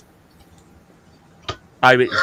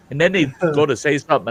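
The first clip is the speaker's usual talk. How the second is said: ordinary delivery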